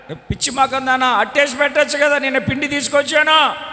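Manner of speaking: the mother protesting she brought flour; exasperated mother. Retold or imitated. Mother answering the husband in exasperation, fast.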